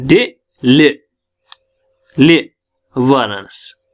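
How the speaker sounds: ले pressed harder, set apart by pauses; syllables split, with pressure on the ले; stress on ले. Shown as a demonstration. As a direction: lean on ले after a separate डे. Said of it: "तो यहां ल पे दबाव".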